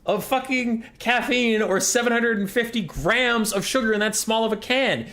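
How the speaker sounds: high-pitched, worked-up comic outrage; exasperated rant. Acted. Chemist fuming that podcast hosts thought grams, exasperated rant. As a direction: rant in squeaky, incredulous indignation. Ranting about the podcast's mistake.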